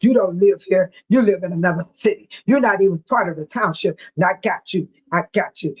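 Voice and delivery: low-pitched mock accusing voice; William Moore. Man telling outsiders they don't belong, low mocking impression. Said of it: "Then William Moore, he walking around".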